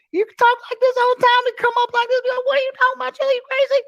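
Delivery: extremely high, excitable squeal of banter; high-pitched friend impression. Imitating his Brooklyn friend teasing him, squeaky high. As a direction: push the voice way up, playfully outraged. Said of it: "My best friend in L A".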